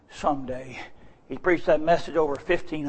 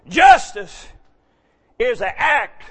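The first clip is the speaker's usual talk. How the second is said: justice hit higher and louder; louder second justice; 6 semitones higher, 4 dB louder, slower than usual. Acted. Preacher driving the word home, louder. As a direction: punch the repeated justice.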